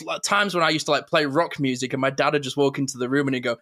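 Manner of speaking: normal talking voice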